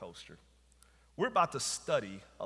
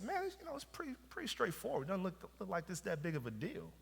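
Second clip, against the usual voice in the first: higher, softer breezy bravado; mock casual confidence; 8 semitones higher, 4 dB quieter than usual. Pastor voicing his younger self sizing up the coaster, mock-casual and high. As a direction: act unbothered by the ride, pitched up. Retold boast before the ride.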